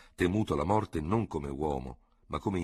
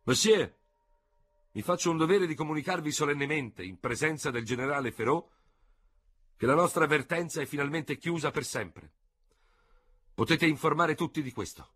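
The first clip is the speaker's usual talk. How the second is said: higher, louder solemn announcement; loud and distinct; 10 semitones higher than usual. A narrator's reading. Dubert announcing the end of the feud to the seconds, loud and distinct. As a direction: proclaim it clearly to the seconds. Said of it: "con voce alta e distinta".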